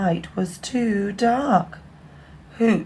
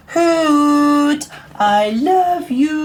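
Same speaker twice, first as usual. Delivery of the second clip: higher, louder call, slow on I love you; brave loud call; 8 semitones higher, 7 dB louder, much slower than usual. Acted. Big owl calling bravely across the wood. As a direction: call out with all your courage. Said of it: "called out very bravely and very strongly".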